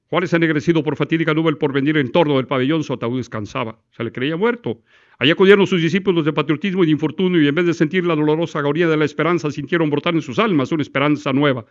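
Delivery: loud, fast recital of a patriotic text; fast, ringing oratory; declamation demo. Shown as a demonstration. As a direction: declaim it crisp and fast, no stumbling. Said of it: "no hay tropiezo en la dicción".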